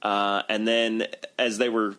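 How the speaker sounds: ordinary talk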